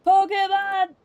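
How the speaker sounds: loud, high-pitched cheer; excited shout. Real, not acted. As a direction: shout it out with joy. Excited shout at game name.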